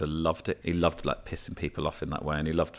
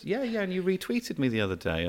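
eager reply; 12 semitones higher than usual. Retold or imitated. His own eager reply retold.

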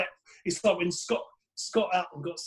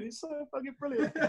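laughing; 9 semitones higher, 4 dB quieter than usual. Real, not acted. Laughing at own story.

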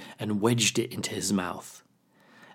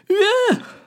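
sharp high-pitched yelp of pain; 9 dB louder than usual. Acted. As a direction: yelp as if nipped hard. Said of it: "Raccoon yelped".

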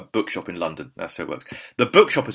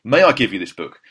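louder, earnest retold request; emphatic offer; 2 semitones higher, 7 dB louder than usual. Host offering his only copy to a star, emphatic. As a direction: make the formal offer boldly.

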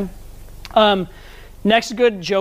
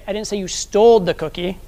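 "stole" punched mid-sentence; emphasis on the word "stole"; stress on STOLE. Shown as a demonstration. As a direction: lean on "stole", as if disputing that very act. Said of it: "wherever you put the emphasis".

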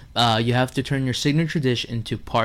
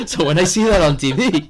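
ordinary talk

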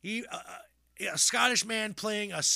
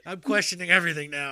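laughing; 7 dB louder than usual. Retold or imitated. Acting out disturbed viewer.

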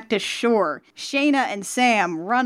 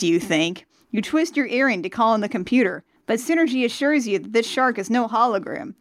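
ordinary delivery

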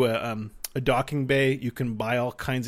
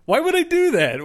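mock outrage; 7 dB louder than usual. Mock exasperated rant.

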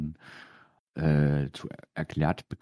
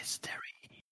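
whisper; 20 dB quieter, slower than usual. Pretend. Spooky echo of mystery music.

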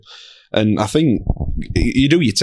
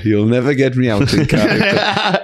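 slow, higher sitcom quote; quoting a TV line; 4 semitones higher, slower than usual. Retold or imitated. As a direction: drawl out the sitcom line slowly. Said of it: "did we say".

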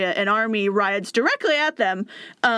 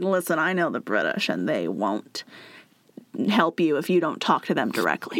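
lightly voiced persuader; 6 semitones lower than usual. Josiah convincing the seven-year-old prince, lightly lowered. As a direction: lean in and persuade gently.

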